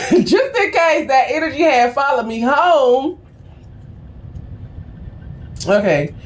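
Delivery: ordinary talk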